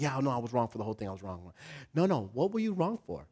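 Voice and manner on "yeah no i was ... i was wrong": mumbled apology. Retold or imitated. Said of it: "I'm like".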